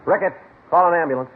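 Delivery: high, loud call across the room; calling out an order. Acted. Detective shouting to Ricketts across the scene to call an ambulance. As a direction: shout the order to a fellow cop.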